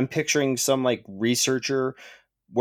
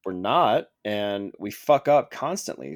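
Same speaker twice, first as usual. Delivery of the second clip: usual speaking voice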